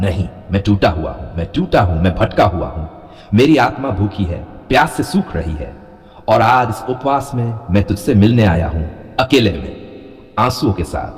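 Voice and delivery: ordinary delivery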